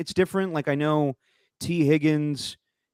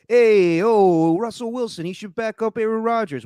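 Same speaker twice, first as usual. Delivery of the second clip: hammed-up, high-pitched pundit caricature; Mike Tannenbaum; 9 semitones higher, 5 dB louder than usual. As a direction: call it out high, mocking a TV pundit's hot take. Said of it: "The Mike Tannenbaum's like".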